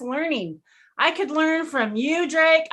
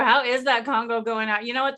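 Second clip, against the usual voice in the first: normal talking voice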